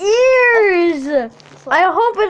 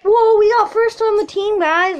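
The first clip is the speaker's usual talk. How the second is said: usual speaking voice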